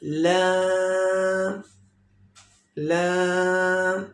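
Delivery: very slow drawn-out "लाम"; stretched laam. Shown as a demonstration. Teacher stretches the letter for students, very slow. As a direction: hold "लाम" long. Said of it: "जिन हरूफ को मैं खींच रहा हूँ".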